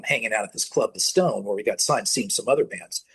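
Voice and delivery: normal talking voice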